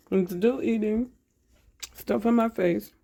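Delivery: talking while chewing; words round a mouthful of bread; mouth full. Real, not acted. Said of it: "I shouldn't be talking with my mouth full".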